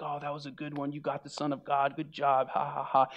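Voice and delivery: soft, imitated soldiers' jeering; mock congratulations with a spoken "ha, ha, ha"; mocking laughter. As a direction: quietly act out mocking banter. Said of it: "laughter that's erupting as they're mocking him".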